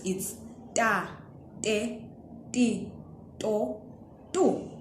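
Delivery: "ta, te, ti, to, tu" with a plain unaspirated t, no puff of air; soft t without breath; plain unaspirated T syllables. Shown as a demonstration. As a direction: say each syllable crisply, unaspirated. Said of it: "When it's standing on its own like this".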